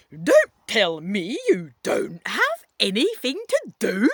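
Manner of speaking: loud shout in a put-on accent; funny-accent shout. A narrator's reading. Stern man scolds idle kid, shouting in funny accent. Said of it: "he shouts".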